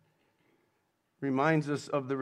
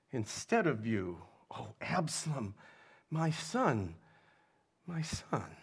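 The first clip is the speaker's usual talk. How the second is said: quiet weeping lament; 5 dB quieter than usual. A narrator's reading. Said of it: "and wept".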